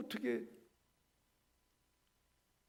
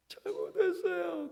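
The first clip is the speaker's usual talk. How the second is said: put-on child giving in; 9 semitones higher, slower than usual. Child finally confessing.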